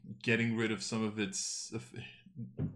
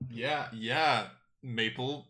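normal talking voice

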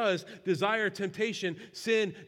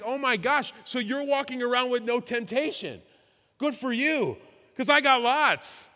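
louder, higher mock astonishment, last words slowed; mock amazement; 5 semitones higher, 6 dB louder than usual. Preacher teasing the congregation about temptation, mock amazed. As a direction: tease them with sarcastic wonder. Preacher mocks prayerless people.

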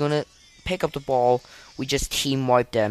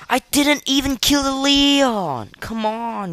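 frustrated yell; 5 dB louder than usual. Real, not acted. Frustrated gamer yelling at game.

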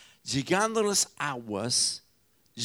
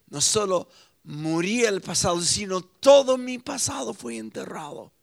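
fiery preaching; 4 semitones higher, 4 dB louder than usual. Acted. Preaching at full force.

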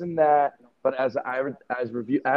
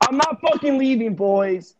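defiant shout; 10 semitones higher, 5 dB louder than usual. Pretend. Defiant echo of host's line.

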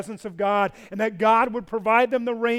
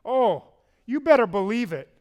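ordinary talk, nothing marked

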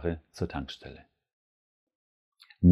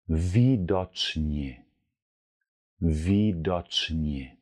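Widocznie drawn out syllable by syllable; very slow demo; much slower than usual. Shown as a demonstration. Teacher slowly models widocznie for learners. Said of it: "auf Polnisch".

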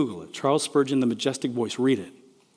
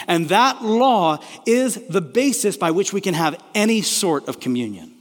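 raised, higher preaching voice voicing God; impassioned preaching; 6 semitones higher, 4 dB louder than usual. Preacher voicing God's words to the congregation, impassioned. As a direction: preach it out with full force, pressing each phrase.

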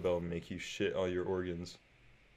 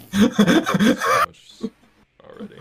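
laughing; 13 semitones higher, 17 dB louder, slower than usual. Real, not acted. Laughing at a crude joke.

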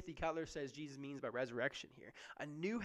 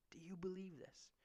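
soft whispered repeat to Martha; 8 dB quieter than usual. Acted. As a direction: whisper the question back softly. Repeat of Jesus's question, whispered.

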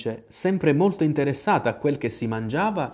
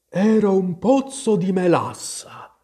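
higher drowsy creature voice; dozy storybook animal, pitched up; sleepy Dormouse; 7 semitones higher, 4 dB louder than usual. A narrator's reading. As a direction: answer as if just surfacing from sleep.

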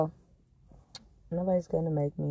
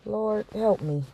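ordinary talk

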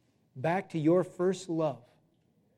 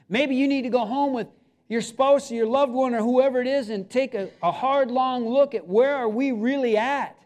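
raised, urgent pleading sermon; preaching at full force; 8 semitones higher, 7 dB louder than usual. Acted. Pastor pleading with the congregation to get right with God. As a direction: plead with them, rising and insistent.

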